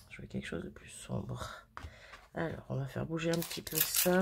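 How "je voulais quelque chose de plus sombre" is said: répété dans un souffle, plus grave et sans voix; chuchoté. Real, not acted. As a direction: se le redit tout bas, déçue. Répétition soufflée pour elle-même. (murmure déçu pour elle-même)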